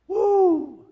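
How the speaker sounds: high, loud ecstatic woo; joyful whoop. Retold or imitated. Preacher acting out someone thrilled by good news, loud whoop. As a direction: cheer as if handed concert tickets. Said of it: "you would be like".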